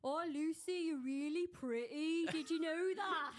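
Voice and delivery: high, loud mock Brummie, drawn out slowly; hammed-up Birmingham lad chatting her up; Birmingham accent. Lad flirting on holiday, in a mocking Birmingham accent. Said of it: "Can you do the accent?".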